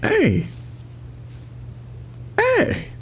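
high sharp call into the dark. Real, not acted. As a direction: shout it up high. Calling out to unseen presence.

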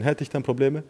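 usual speaking voice

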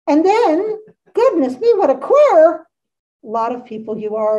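high, loud storybook exclamation; exclaiming. A narrator's reading. Rubber Bear looking at the assembled company, exclaiming. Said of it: "exclaimed the Rubber Bear".

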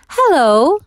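a friendly chorused greeting; dwarfs chorusing. A narrator's reading. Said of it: "they chorused".

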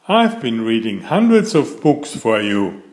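ordinary delivery

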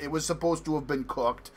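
ordinary talk, nothing marked

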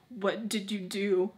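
hammed-up gasp, then slow question; dramatic. Daughter confronting mom, dramatic gasp and question. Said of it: "All dramatic".

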